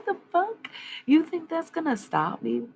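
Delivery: loud and high, daring them to try; a loud, scoffing comeback; defiant shout. Real, not acted. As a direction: throw the challenge back, indignant. Defying the unseen attacker.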